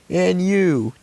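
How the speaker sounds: high happy greeting; high excited greeting. Acted. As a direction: greet the pet with delight. Child voicing Anu.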